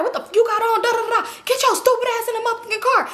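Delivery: high, heated mock telling-off; getting at her. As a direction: scold her in an imitated outburst. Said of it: "he getting at her like".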